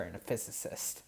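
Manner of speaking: whispered, fading; unvoiced trail. Real, not acted. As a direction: breathe the last words out. Trails off voicelessly.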